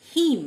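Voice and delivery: "হিম" ending on M; silent last N. Shown as a demonstration. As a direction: model "হিম" with no final N. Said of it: "last এন লেটারটি silent". ("হিম", শেষের এন উচ্চারণ না করে)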